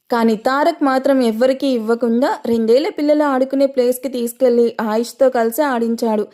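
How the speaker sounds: ordinary delivery, nothing marked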